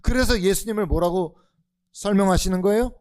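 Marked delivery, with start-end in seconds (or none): none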